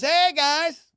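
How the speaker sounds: hammed-up fake-friendly hello; fake nice. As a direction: imitate a cop being falsely friendly. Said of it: "they do that fake nice shit".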